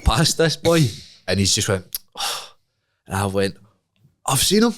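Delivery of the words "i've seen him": high, urgent I've seen him; excited whisper-shout. Retold or imitated. Telling his mate in Asda, higher and excited. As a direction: blurt it out, excited. Retold spotting the guy.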